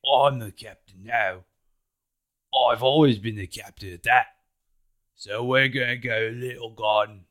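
loud, defiant insistence; captain overruling the crew, mildly hammed-up; stubborn declaration. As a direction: plant your feet and pull rank. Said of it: "Luffy's like".

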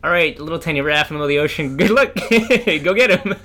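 loud, laughing mock send-off; mocking laughter. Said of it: "it's not like".

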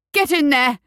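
high, loud urgent order; shout. A narrator's reading. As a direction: yell them through the door. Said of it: "McMorning shouted".

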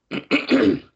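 choking mid-sentence; words catch in the throat. Real, not acted. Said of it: "I'm choking".

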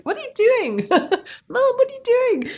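high-pitched imitation of a child catching mum out; hammed-up, high questioning of mum; mock appalled child. Child interrupting mum in imagined scene.

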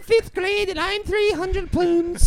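very high, slow Irish caricature; mock him in a squeaky, stretched Irish voice. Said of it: "doing an Irish voice".

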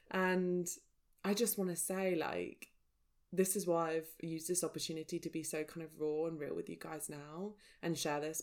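ordinary talk

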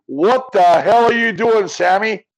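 imitating an angry coach chewing out his quarterback. Retelling how the coach scolded his quarterback, mock angry yell. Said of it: "and Cal goes".

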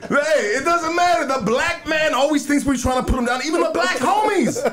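high-pitched mock outrage; mock-indignant protest. Real, not acted. Host protesting the barbecue sauce joke, mock indignant. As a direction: pitch the complaint way up, hammed-up.

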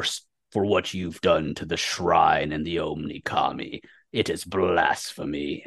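very low, soft menacing growl; Taraki. Acted. Taraki avenging the desecrated shrine, low quiet menace. Taraki threatens foes, acted by game master.

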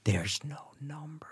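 slow, hushed disbelieving whisper; stunned whisper. Real, not acted. Learning the movie title has no number, a stunned whisper. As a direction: breathe it out in quiet shock.

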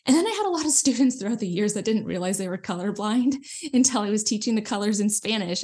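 ordinary talk, nothing marked

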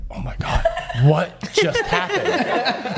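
breathless, drawn-out panic; dad in shock after the otter attack, stunned and panicky; freaked-out dad. Retold or imitated. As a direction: gasp it out in disbelief. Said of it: "he's freaking out".

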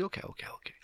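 whispered like an unbothered boss; calm whispered okays. Retold or imitated. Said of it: "Swiss guy, he's very calm".

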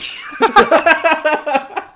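loud, high cackling laugh; burst of laughter. Real, not acted. Friend cracking up at the twelve thirty story, loud laughter.

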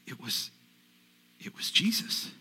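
breathless hushed realisation; stunned quiet whisper; awed whisper. Acted. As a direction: whisper it in wonder. Awed recognition of Jesus.

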